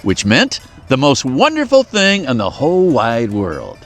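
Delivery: ordinary delivery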